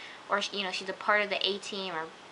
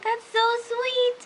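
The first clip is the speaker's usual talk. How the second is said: much higher, slow and louder, melting; gushing; 13 semitones higher, 4 dB louder, much slower than usual. Retold or imitated. Fan reacting to a sweet couple moment, gushing high and slow. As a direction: swoon over it.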